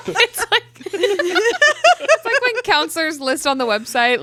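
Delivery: high, breaking up with laughter; laughing. Real, not acted. Co-host extends counselor joke, laughing hard. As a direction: struggle to talk through real laughter.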